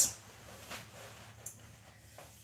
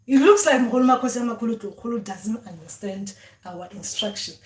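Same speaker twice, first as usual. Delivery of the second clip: ordinary delivery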